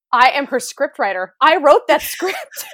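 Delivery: high, loud, slow indignant laugh; indignant disbelief. Retold or imitated. Speechwriter watching her sister nail it, loud indignant laugh. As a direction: claim your credit in outrage. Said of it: "I was like".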